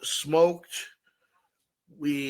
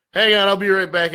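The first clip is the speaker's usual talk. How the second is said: raised, higher quick aside to a cashier; retold calling out; 6 semitones higher, 8 dB louder than usual. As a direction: call it out louder and higher. Quote of telling the cashier.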